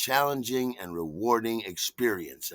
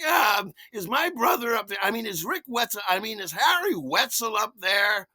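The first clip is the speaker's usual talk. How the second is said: sister on intercom; 9 semitones higher than usual. Retold or imitated. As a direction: squawk it like a sister on a tinny intercom. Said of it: "my sister's voice coming out of the little crackly speaker".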